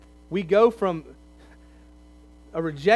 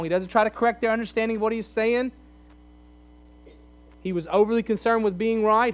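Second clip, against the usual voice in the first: usual speaking voice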